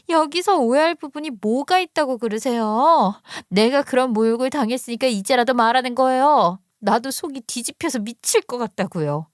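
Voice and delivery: acted upset daughter; indignant daughter. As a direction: protest to your mother, exasperated but not shouting. Narrator voices angry sister-in-law.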